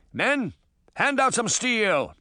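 calling out orders. A narrator's reading. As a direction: call to the crew, drawing out the order. Captain calling orders to crew.

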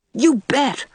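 put-on high, punched YOU BET from a small boy; emphatic. A narrator's reading. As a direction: hit "You bet" hard and high, then drop. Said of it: "said Davy emphatically".

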